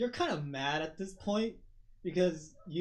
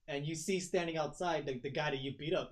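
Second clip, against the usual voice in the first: usual speaking voice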